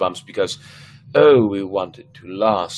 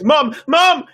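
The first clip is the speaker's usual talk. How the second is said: high, loud frightened call; calling for mom; 8 dB louder than usual. A narrator's reading. A scared boy cries out for his mother, loud frightened call. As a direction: shout for mom in terror.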